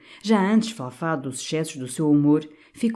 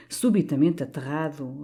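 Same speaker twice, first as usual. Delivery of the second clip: ordinary delivery, nothing marked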